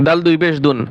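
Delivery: normal talking voice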